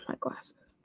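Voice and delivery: breathy whispered aside under a sigh. Real, not acted. Muttering to herself about her glasses, whispered. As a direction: drop to a whisper for a quick aside.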